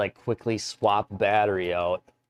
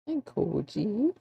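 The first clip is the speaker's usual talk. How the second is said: talking to a dog; 12 semitones higher, 5 dB quieter than usual. Real, not acted. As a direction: coo softly at the puppy. Cooing at the dog.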